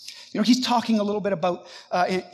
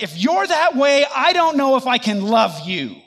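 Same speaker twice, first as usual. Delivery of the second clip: loud, higher complaint; griping; 4 semitones higher, 6 dB louder than usual. Acted. Believer under a tiny tree gripes with God, loud and aggrieved. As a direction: gripe heatedly, straight at God. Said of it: "I kind of gripe with God".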